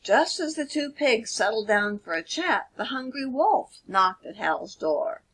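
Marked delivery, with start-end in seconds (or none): none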